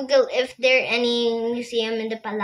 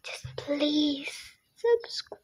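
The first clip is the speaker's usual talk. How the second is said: whispering; 3 semitones higher, 8 dB quieter, slower than usual. Real, not acted. Whispered plea to viewers.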